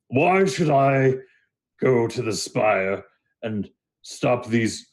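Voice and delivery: very slow, dismissive Minotaur voice; ponderous, doubtful Minotaur; skeptical Minotaur. Acted. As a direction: draw out the question with disdain.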